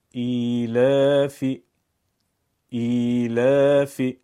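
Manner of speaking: ایلافی with the long i held two beats, slow and loud; ایلافی با کشش دو ضربی; drawn long i. Shown as a demonstration. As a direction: stretch the ی in ایلافی, slowly. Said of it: "نگاه کنید در کلمه".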